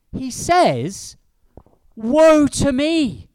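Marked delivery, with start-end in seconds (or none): 2.0-3.3 slow, loud wail of woe; anguished cry. Retold or imitated. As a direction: cry it out high and drawn, in despair. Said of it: "I cried".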